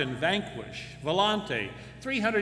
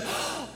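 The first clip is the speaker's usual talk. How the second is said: shocked gasp; 4 dB quieter than usual. Acted. Man learns arm cut off.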